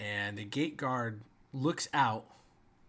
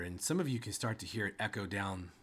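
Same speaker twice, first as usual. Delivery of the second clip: ordinary talk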